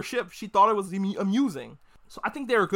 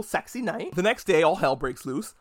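usual speaking voice

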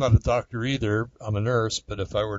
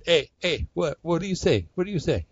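higher, baffled what-do-you-say; puzzled Italian listener; 7 semitones higher than usual. Retold or imitated. A listener in Italy, lost by fast talk, mock puzzled. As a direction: playfully mimic a confused Italian. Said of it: "he'd, he'd be going".

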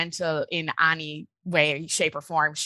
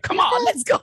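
high, eager cartoon-pup cry; Scrappy Doo; 12 semitones higher, 4 dB louder than usual. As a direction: pump it up like a scrappy little cartoon dog. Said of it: "I was like Scrappy Doo".

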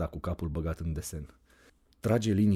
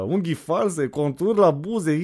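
higher and louder, slowly sneering "contur la buze"; scornful mockery; 9 semitones higher, 7 dB louder, slower than usual. Anda sneering at Iulia's looks, slow and scornful. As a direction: drag out "contur la buze" with contempt. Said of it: "pufnește Anda".

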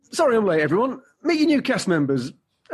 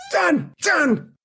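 strained, higher call; throat-affected voice; 6 semitones higher than usual. Acted. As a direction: call out urgently through an irritated throat. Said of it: "What's up with your voice?".

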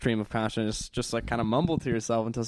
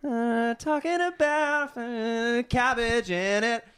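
much higher, slow dopey drawl; dopey singer voice; 11 semitones higher, much slower than usual. Pretend. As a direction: noodle on the words like a spaced-out strummer. Imitating a rambling guitar strummer.

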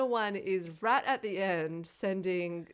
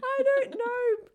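much higher and slow, mock innocent; squeaky I don't know; 7 dB louder, much slower than usual. Answering her cohost, a high, slow mock-innocent shrug. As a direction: drawl it high like a cartoon. Comic reply in put-on voice.

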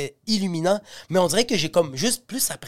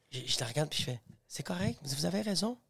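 très bas; 14 dB quieter than usual. Retold or imitated. Récit chuchoté, résigné.

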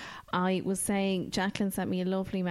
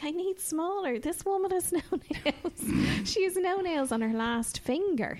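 high, lightly mocking voice of the nail girl; a hammed-up nail girl with a laugh slipping in; imitating nail technician; 11 semitones higher than usual. Nail lady quoted again.

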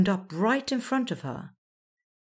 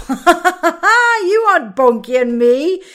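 slow, much higher, laughing line; 12 semitones higher, 12 dB louder, slower than usual. A narrator's reading. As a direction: giggle through the words. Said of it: "Raga laughed so hard".

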